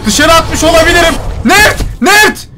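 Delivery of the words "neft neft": high, shouted call of a name. Real, not acted. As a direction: shout the name across the room. Calling out to his character. (yüksek sesle seslenerek)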